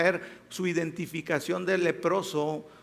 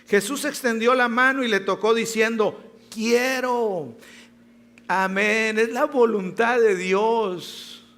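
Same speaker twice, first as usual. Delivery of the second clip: louder, rising preacher's proclamation; preacher proclaiming God's will, fervent; fervent preaching; 4 semitones higher, 4 dB louder than usual. Acted. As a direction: proclaim it to the congregation. Preaching at full force.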